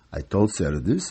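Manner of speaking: ordinary delivery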